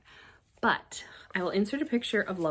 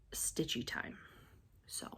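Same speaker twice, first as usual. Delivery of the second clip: soft whisper; 2 semitones lower, 14 dB quieter than usual. Real, not acted. Crafter whispering to viewers, hushed. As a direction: whisper the last words.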